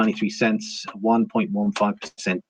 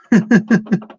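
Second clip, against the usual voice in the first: high laugh; 9 semitones higher, 5 dB louder than usual. Real, not acted. Laughing at a chat comment.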